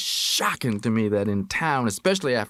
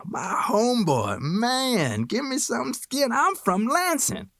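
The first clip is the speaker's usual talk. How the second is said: much higher, slow, excited street voice; Shorty thrilled, pitched way up; delighted hipster; 13 semitones higher, slower than usual. Acted. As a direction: burst out with joy at finding a homeboy. Shorty thrilled to meet homeboy.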